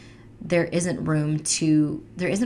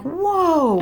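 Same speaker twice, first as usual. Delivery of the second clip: shocked whoa; 8 semitones higher, 5 dB louder than usual. Retold or imitated. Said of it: "I'm like".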